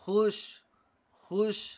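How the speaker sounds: खुश said short and loud with no drawn-out वाव; खुश with only a hint of वाव; demo reading. Shown as a demonstration. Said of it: "वाव को बिल्कुल खींचकर और साफ जाहिर करके नहीं पढ़ा जाएगा". (वाव खींचे बिना खुश पढ़ना)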